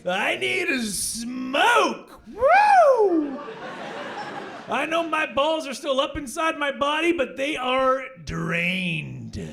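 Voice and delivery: high, slow, loud blissed-out drawl after sex; spent newborn. Acted. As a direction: play the baby as a wrecked, satisfied man, shouting then sighing. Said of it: "he's gonna come out like".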